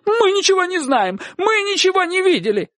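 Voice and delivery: very high, urgent denial; crying out. A narrator's reading. Mother herding Sonka home, crying out denial. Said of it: "крича".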